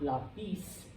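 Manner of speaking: lapis as la-PIS, rising; stress on last syllable. Shown as a demonstration. Teacher rejects the wrong version, lapis stressed on PIS. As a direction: lean on the final PIS of lapis. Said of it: "If it followed the rule".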